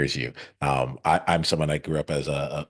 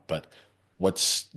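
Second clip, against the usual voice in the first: usual speaking voice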